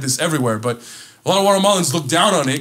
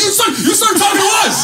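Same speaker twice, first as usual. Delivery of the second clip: high shouted re-enactment of an argument; loud, high retold outburst; upset; 5 dB louder than usual. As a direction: act out yelling back at him, worked up. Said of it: "I was getting upset at him".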